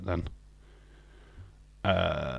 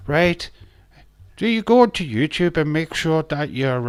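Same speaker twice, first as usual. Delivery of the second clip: high, loud stage-Irish mockery; over-the-top Irish accent to poke fun; 6 semitones higher, 5 dB louder than usual. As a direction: tease a mate with a hammy Irish accent. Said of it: "he was trying his best Irish accent there".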